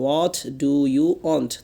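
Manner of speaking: What do you want? drawn out word by word, very slow; slow teacher's repeat; very slow drill. Shown as a demonstration. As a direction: stretch each word so students can repeat. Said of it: "What do you want? (first take)".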